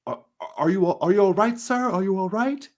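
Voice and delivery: high, loud, worried calling; panicked call from upstairs. Acted. GM voicing a frightened servant calling upstairs, loud and high. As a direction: shout down the stairs in alarm. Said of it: "someone says".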